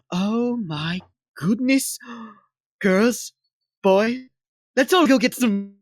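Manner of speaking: high, gasping, excited gushing; thrilled devotee. Acted. Devotee rallying friends to go get some, thrilled. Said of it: "hands on her face and she goes".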